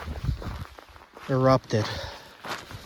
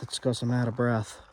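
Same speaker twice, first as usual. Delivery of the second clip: usual speaking voice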